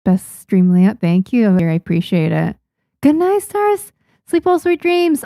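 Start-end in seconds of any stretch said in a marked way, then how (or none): 3.0-5.2 much higher, sing-song goodnight to the viewers; tucking the chat into bed, voice lifted way up; sweet goodnight. Acted. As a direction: coo a high little bedtime farewell. Bidding viewers goodnight at stream end.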